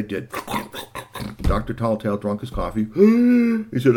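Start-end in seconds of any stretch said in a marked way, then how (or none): 2.9-3.7 loud, high sharp gasp; sudden loud intake of breath; startled gasp. Acted. Doctor notices time.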